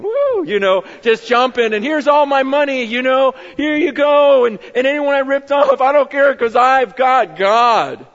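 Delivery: high, loud, bouncy cheer; a small man hopping with glee over God; little jumping man. Pretend. Zacchaeus hands his money out to everyone, overjoyed and loud. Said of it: "this little Danny DeVito guy going".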